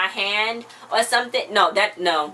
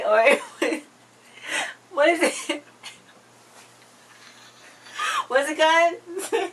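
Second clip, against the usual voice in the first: higher and slow, words melting into laughter; laughing; 8 semitones higher, slower than usual. Real, not acted. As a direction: giggle helplessly while searching for the name. Laughing, can't recall title.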